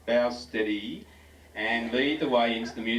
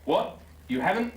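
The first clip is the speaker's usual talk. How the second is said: a mildly raised, higher exclamation; lightly raised indignant cry; 8 semitones higher, 3 dB louder than usual. Acted. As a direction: push the voice up in mock alarm. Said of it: "Anthony raised his voice".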